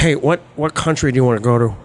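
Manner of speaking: a hint of Dog Day Afternoon Pacino; young Pacino. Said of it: "I do young Pacino".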